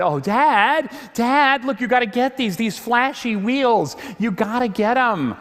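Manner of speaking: put-on high, eager child voice pleading with dad; excited little daughters. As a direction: imitate six-year-olds begging for flashy wheels. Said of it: "and they said".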